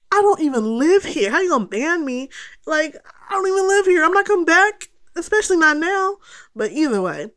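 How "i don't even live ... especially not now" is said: high-pitched, incredulous objection; worked-up, higher-voiced complaint; indignant protest. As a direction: re-enact arguing against an unfair ban. Retold protest at being banned.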